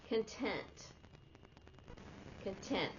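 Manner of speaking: "content" said kun-TENT; "content" as in satisfied, kun-TENT; second-syllable stress. Shown as a demonstration. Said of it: "you say it differently".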